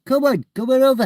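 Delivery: high loud call to get attention; loud high-pitched call; calling out loudly. Real, not acted. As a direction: shout across to a stranger. Calling out to man outside.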